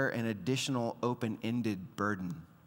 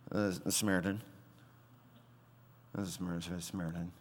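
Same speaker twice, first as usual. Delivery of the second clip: softer and fast, swallowing the word Samaritan; embarrassed mumble; 6 semitones lower, 7 dB quieter, much faster than usual. Retold or imitated. The lawyer mumbling his unwelcome answer to Jesus, embarrassed and quiet. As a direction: mumble it so nobody quite hears. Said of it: "could you speak up a little bit".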